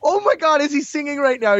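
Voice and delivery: high, amused disbelief; mock amazement. Podcaster retelling her shock at the singing droid, mock surprised.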